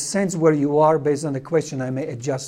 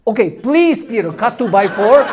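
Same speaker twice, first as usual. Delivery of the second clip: mocking correction; 4 semitones higher, 7 dB louder than usual. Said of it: "he looks at me and like".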